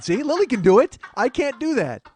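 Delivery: loud, high comic protest; exasperated. Real, not acted. Host failing at the pop noise, comic exasperation. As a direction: raise your voice in playful frustration.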